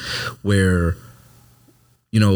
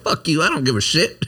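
higher-pitched dismissive comeback; mock-defiant retort in a higher voice; retold defiance; 7 semitones higher than usual. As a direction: brush him off defiantly, pitched up. Retold defiant argument with his superior.

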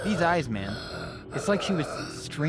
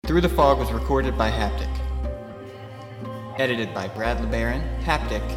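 podcast outro. Real, not acted. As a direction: clear announcer credits read. Outro credits read.

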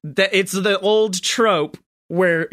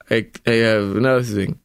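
put-on very old man; 8 semitones lower than usual. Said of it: "He's just like".

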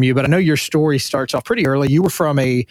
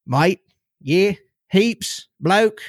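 higher-pitched mock Aussie slang; Australian accent; 5 semitones higher, much slower than usual. Shown as a demonstration. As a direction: toss out amateur Australian-accented words one by one. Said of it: "as good as my Australian gets".

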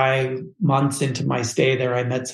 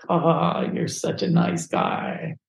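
high, airy whisper of a kindly Italian boss; imitating Luigi; 5 semitones higher, slower than usual. Agency owner Luigi gushing over the lonely kid, high whispery coo. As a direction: coo it like the boss melting at your story.

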